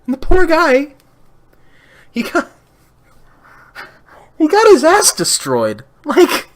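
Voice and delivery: high, loud, indignant ranting; worked-up outrage. Real, not acted. Pitying Michael Cole in high, loud outrage.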